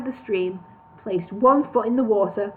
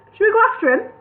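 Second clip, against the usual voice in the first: high, louder worried question; anxious hobbit pleading to go after him; worried Bingo; 12 semitones higher, 5 dB louder, faster than usual. A narrator's reading. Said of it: "Bingo asked in a worried voice".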